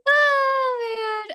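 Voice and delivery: sustained high "oh" winding down from laughter; drawn-out high-pitched "oh"; long high sigh after laughing. Real, not acted. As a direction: let out a long, high post-laugh wail.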